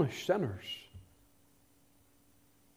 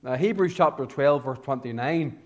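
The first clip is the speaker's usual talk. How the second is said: normal talking voice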